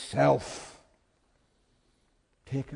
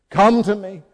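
higher and much louder, a full cry; ringing call; 7 semitones higher, 12 dB louder than usual. Acted. Jesus summoning the congregation, crying out loudly. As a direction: call out with all your strength.

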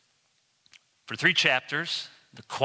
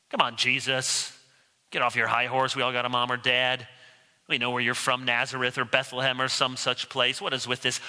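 dismissive scoff; 6 semitones lower than usual. Acted. Skeptic scoffs at Jesus' lofty talk.

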